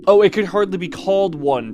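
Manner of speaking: mock dramatic narrator; weird dramatic reading. Shown as a demonstration. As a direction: overact the line for fun. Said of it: "I was reading that in a weird way".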